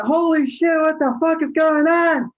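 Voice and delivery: high-pitched retold panic; shocked outcry. Passenger in shock as his friend screams, imitated alarmed outcry. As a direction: imitate a startled man crying out.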